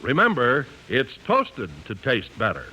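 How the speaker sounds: ordinary delivery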